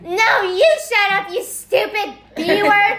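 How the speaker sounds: high, loud, slow mock yell; imitated screaming match. Imitating Ian yelling back in family fight, mock furious. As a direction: hurl it back in a hammed-up shout. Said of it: "family was screaming at each other".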